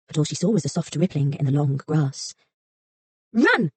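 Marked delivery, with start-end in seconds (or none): 3.3-3.7 cry out. A narrator's reading. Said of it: "cried the fox".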